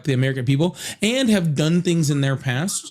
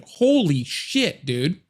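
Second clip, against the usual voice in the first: stunned; much slower than usual. Real, not acted. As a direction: stretch it out in disbelief. Reacting to a screaming kid.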